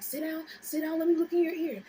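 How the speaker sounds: high, insistent mimicked mom; mom taking charge. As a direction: imitate a mother ordering a panicked kid. Mom voiced instructing her.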